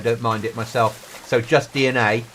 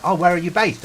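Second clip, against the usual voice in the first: higher, chirpy questioner; imitated curious civilian; 7 semitones higher than usual. Civilian asking a squaddie where he serves, mock curiosity. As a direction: put on an innocently curious voice. Retold small talk with civilians.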